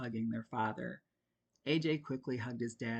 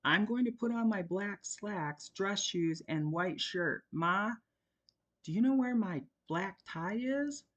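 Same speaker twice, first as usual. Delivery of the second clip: put-on higher boy's voice, called out; teen yelling; 7 semitones higher than usual. A narrator's reading. A boy calls out hurrying. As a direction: son yells while fleeing upstairs. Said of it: "yelling over his shoulder".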